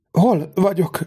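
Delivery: high, tearful faltering question; sobbing stammer. A narrator's reading. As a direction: stammer it through sobs. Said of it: "hebegte zokogva".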